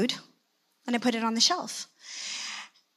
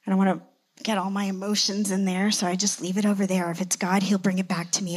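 normal talking voice

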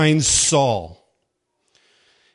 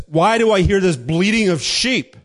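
higher, louder demanding question; confronting Samuel; 7 semitones higher, 6 dB louder than usual. Retold or imitated. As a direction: call Saul out on the lie. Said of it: "he's confronted by Samuel".

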